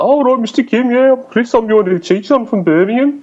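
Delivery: high-pitched, exaggerated Birmingham accent; thick Birmingham accent. Young teacher introducing himself to Mister Kim, thick Birmingham accent. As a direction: send up your younger self in broad Brummie. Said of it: "my thick Birmingham accent".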